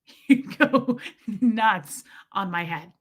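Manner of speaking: slow words broken by a laugh. Real, not acted. Telling friends about the chiropractor, laughing. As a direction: laugh through it as you tell it.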